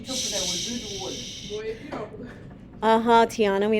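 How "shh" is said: shushing. Real, not acted. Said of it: "Quiet now, children".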